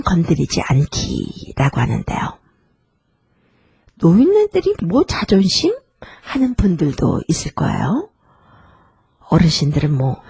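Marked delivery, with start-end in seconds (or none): 4.0-5.7 high, incredulous dismissive question; mock scoffing. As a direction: scoff at the idea, pitched up. Voices people dismissing elders' pride. (비웃듯 높은 톤으로 되묻기)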